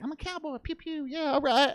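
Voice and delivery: high, soft little cowboy play voice; playful cowboy boast. Retold or imitated. Rider retelling his cowboy fantasy, playful boast.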